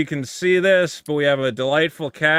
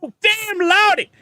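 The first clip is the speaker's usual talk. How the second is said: much higher, put-on falsetto outrage; caricatured woman in shower. Host mocking the character in the shower, high falsetto put-on voice. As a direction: shriek it like a stock sitcom character. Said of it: "she's going".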